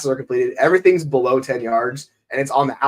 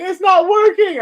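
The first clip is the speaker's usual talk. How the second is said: squeaky upset voice, much higher; high whiny complaint; 6 dB louder, slower than usual. Retold or imitated. Speaker imitating his partner upset over the broken inflatable, high squeaky whine. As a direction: mimic her pitching up high in dismay. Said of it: "And she's like".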